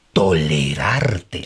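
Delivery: low, very slow 'tolerarte', syllable by syllable; disdainful drawn-out word. Shown as a demonstration. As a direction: stretch 'tolerarte' out with heavy distaste. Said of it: "Haz que hasta la palabra".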